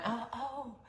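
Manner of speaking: high, startled little cries; shocked gasp. Retold or imitated. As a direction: recoil at the suggestion. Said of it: "like, went".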